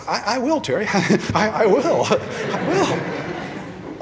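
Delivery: high, laughing promise; nervous laughing pledge; laughing vow. Retold or imitated. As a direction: blurt the promise through laughter. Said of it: "I said".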